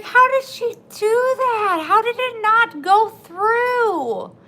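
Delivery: high, loud, slowed astonishment; slow, loud, high and baffled; amazed disbelief. Retold or imitated. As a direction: ask in stunned wonder. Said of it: "I'm like".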